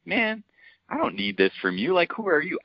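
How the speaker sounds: retold, a fed-up who-are-you comeback; young coach snapping back at his elder, imitated exasperation; exasperated retort. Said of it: "I'm looking at him like".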